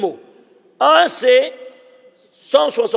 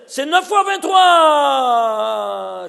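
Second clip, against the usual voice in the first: slow emphatic exclamation; 2 semitones higher, 5 dB louder, much slower than usual. Real, not acted. Teacher stressing a revelation.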